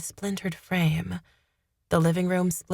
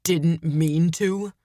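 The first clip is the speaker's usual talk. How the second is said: slow, disgusted echo; 3 dB louder, much slower than usual. A narrator's reading. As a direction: throw the words back in disgust. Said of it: "threw my words back at me in disgust".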